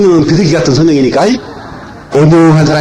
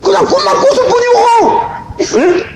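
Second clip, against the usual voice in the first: high mock whine. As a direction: stretch it high like a silly lament. Punchline in high voice. (높고 길게 늘어뜨린 우스운 한탄)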